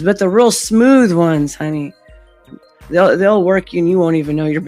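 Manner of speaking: ordinary talk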